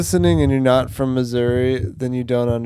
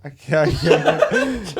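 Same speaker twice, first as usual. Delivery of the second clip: laughing; 4 semitones higher, 4 dB louder, much slower than usual. Real, not acted. Laughing while refusing the shot.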